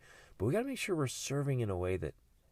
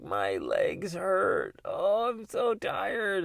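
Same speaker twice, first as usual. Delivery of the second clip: put-on drawn-out high complaining kid, slow; whiny tired kid; 10 semitones higher, 4 dB louder, much slower than usual. Dad voicing his kids complaining on a hike, slow high whine. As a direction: whine like a worn-out child on a hike. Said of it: "the kids be like".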